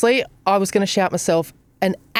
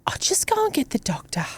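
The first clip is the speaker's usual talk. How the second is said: hushed, higher receptionist voice, nearly whispered; 8 semitones higher, 6 dB quieter than usual. Retold or imitated. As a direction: quote her in a nervous hush, pitched up.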